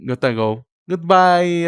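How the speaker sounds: ordinary talk, nothing marked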